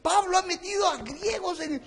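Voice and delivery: high outraged cry of the crowd; mock scandalized outcry, high; scandalized shout. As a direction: shout as an offended temple crowd. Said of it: "Y los judíos dijeron".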